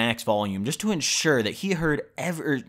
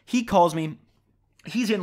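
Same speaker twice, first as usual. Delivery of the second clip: ordinary talk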